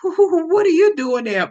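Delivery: high, loud, nervous retold greeting; mock-scared question with a nervous laugh; shaky voice. Daughter startled by her fuming dad outside church, shaky voice. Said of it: "My voice is shaking".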